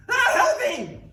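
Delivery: high, loud desperate cry; shrill-pitched wailing plea; screaming for help. Acted. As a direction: scream for rescue in mock agony. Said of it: "He's like".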